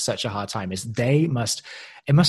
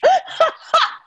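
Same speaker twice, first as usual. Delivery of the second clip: real burst of laughter; 6 dB louder than usual. Real, not acted. Laughing at own impression.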